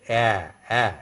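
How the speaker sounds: flattened, spread "a" sound. Shown as a demonstration. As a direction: say "a" flat, lips spread wide. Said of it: "the pronunciation is more flattened".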